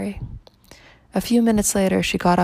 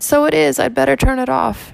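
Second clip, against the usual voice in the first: tearful; 7 semitones higher, 5 dB louder than usual. A narrator's reading. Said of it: "she returned with tearful eyes".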